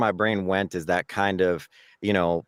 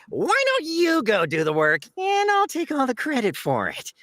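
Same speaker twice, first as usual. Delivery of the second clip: whiny, sleazy salesman, pitched way up; Yan; 14 semitones higher than usual. Acted. Salesman passing off the work, whiny and sleazy. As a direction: whine it high like a sleazy salesman. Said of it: "this whiny, sleazy voice".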